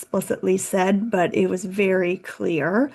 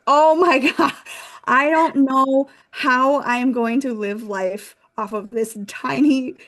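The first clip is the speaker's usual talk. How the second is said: higher, louder, half-laughing panic; panicked; 5 semitones higher, 4 dB louder than usual. Retold or imitated. Young interpreter panicking over her tiny paycheck, higher and louder. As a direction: freak out about the money, laughing in disbelief. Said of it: "I was like".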